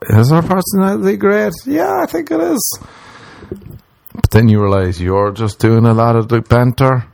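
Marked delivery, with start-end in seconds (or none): 0.1-2.8 very high squeaky self-convincing; squeaky hopeful. Retold or imitated. Man kidding himself about a hot girl, very high squeak. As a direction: talk yourself into it, high and thin.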